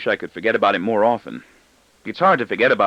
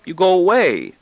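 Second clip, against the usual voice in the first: put-on boy's voice; 9 semitones higher, 6 dB louder than usual. A narrator's reading. Boy voiced, accusing.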